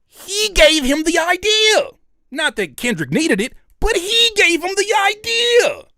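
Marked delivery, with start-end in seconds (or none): 0.1-1.9 incredulous high voice. Real, not acted. Incredulous emphasis, high far voice. 3.8-5.9 incredulous high voice. Real, not acted. Incredulous emphasis repeated.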